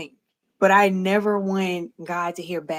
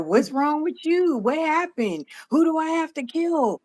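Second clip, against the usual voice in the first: much higher, urgent worried voices; protective friends; 9 semitones higher than usual. Retold or imitated. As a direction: rush in ready to defend her. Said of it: "they're like".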